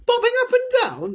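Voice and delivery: very high, loud shocked squeal; the group crying out in alarm; astonished cry. A narrator's reading. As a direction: shriek the question in disbelief. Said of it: "they cried".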